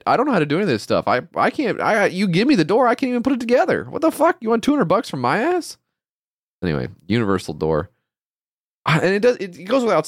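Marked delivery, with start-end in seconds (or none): 1.5-5.8 high, rising incredulous rant; exasperated disbelief. Real, not acted. Ranting that the inventors want two hundred bucks, high exasperated. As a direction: throw up your hands.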